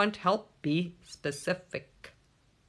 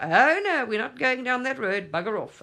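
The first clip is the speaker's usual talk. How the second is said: mock refusal; 6 semitones higher, 5 dB louder than usual. Self talk blocking thoughts.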